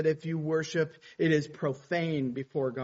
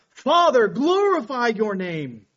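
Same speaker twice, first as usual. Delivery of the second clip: high, loud cry on Father, then slow; desperate cry to the Father, stronger still; 7 semitones higher, 9 dB louder than usual. Acted. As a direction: cry out to heaven. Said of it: "Jesus just cries out".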